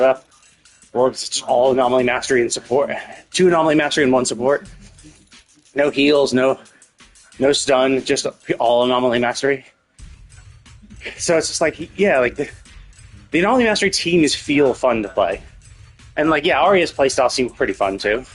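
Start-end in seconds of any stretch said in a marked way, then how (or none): none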